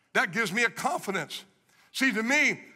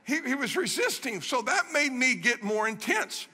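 usual speaking voice

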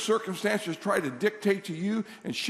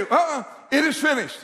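loud, raised-pitch refusal; spoken-out declaration; 8 semitones higher, 5 dB louder than usual. Shown as a demonstration. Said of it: "we need to speak it out loud".

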